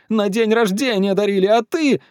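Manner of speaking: put-on high-pitched, aggrieved boy; child's outcry. A narrator's reading. Петя reproaches dad over his birthday present, crying out. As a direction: protest high, like a wronged child. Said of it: "закричал он".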